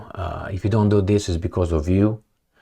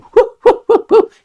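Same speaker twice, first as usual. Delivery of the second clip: short, high, loud laugh; real laugh; burst of laughter; 8 dB louder than usual. Real, not acted. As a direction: crack up at the image. Laughs at own joke.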